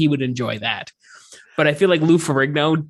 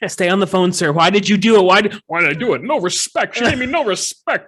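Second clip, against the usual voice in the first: Dangerfield-style killer; 5 semitones higher, 3 dB louder than usual. Acted. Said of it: "playing dispatcher then killer".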